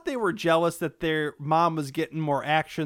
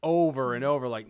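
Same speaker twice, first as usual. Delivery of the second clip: ordinary talk, nothing marked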